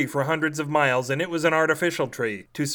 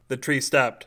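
plain reading voice

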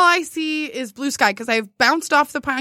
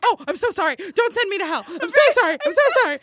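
high, hurried mock pleading; panicked apology; 6 semitones higher, faster than usual. Skier begging God not to send her to hell, mock panicked. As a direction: beg forgiveness in a comic panic.

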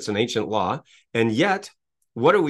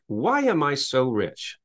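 slow, higher, lifted questions; slow empowering question; 5 semitones higher, slower than usual. Shown as a demonstration. Speaker modeling the empowering questions slowly and brightly. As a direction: savor each question as a sample. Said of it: "you would ask a question like".

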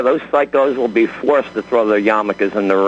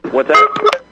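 surprised; 4 dB louder than usual. Real, not acted. Caller missed the host's words.

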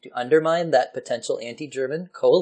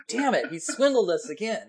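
mock dismay; 8 semitones higher than usual. Nations realizing swindle.

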